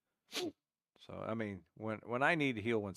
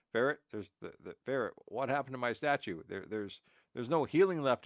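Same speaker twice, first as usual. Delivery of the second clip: louder, stumbling mock protest; mock alarmed complaint; 3 semitones higher, 6 dB louder than usual. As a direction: act out a baffled complaint to a teammate. Acts out complaint to teammate Ferret.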